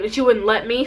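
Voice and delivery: ordinary delivery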